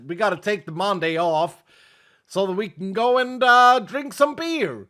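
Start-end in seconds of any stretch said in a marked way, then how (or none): 2.2-4.9 hammed-up American accent, higher, drawn-out words; bad American accent. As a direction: put on a mock American beer-lover's accent. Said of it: "I can't do an American accent".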